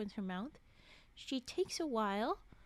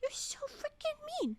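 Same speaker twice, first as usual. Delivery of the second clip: much higher, whiny you're so freaking mean; whiny high complaint. Real, not acted. Streamer scolding a character, high whiny pout. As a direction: pout it out in a squeaky voice.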